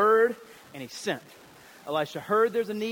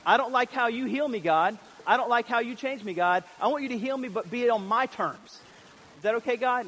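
higher, louder whiny prayer; whiny demand; 5 semitones higher, 4 dB louder than usual. Acted. Congregation's whiny prayer to God, louder and higher. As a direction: pray like a spoiled child. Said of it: "Is that not like us".

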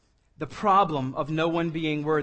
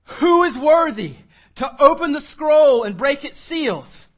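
booming, slow angel's call; loud angel voice proclaiming; loud proclamation; 9 semitones higher, 10 dB louder, slower than usual. A narrator's reading. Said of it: "proclaiming with a loud voice".

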